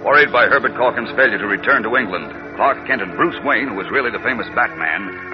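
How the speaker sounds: ordinary talk, nothing marked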